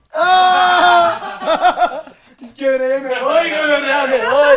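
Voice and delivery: high loud laughing shout; cracking up while pretending to leave. Real, not acted. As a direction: laugh out a mock exit. Mock leaving, laughing.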